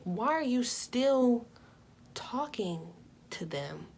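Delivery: usual speaking voice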